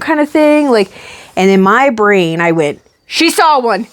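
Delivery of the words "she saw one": high-pitched alarmed realization; hammed-up alarm, pitched high; mock panic. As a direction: imitate a panicked inner voice. Retold panicked thought about shark.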